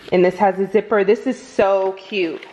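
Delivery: ordinary delivery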